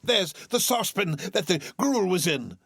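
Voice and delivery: high, breathless joyful exclaim; excited cry. Acted. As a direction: point it out with delight. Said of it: "cried Scrooge".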